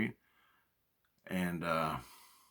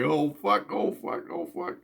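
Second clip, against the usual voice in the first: panicked; 10 semitones higher than usual. Retold or imitated. Bear jumps out fifty feet away.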